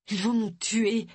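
high, mildly plaintive moan; understated fearful whine. A narrator's reading. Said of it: "gémit Tony".

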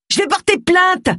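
indignant high-pitched yell. A narrator's reading. Roy threatens the angry fisherman, yelling. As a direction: yell back, pitched right up in outrage. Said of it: "hurla Roy".

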